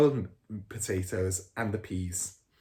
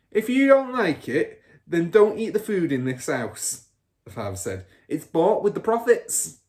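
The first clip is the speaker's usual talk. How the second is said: angry father; 9 semitones higher, 8 dB louder than usual. A narrator's reading. Father snaps at Matilda.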